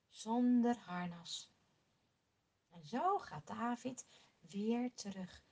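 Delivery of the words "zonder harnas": low and slow, quietly certain; calm firm answer. Acted. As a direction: settle it calmly. David bevestigt kalm. (rustig en beslist, laag en langzaam)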